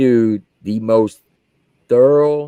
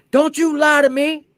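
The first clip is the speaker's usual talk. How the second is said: mock angry colonel. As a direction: bark it like an angry officer. Colonel accusing him.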